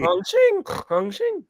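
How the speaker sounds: high squeaky snore noise; snoring sound. Retold or imitated. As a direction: sing it out as a snore. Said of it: "That's so weird".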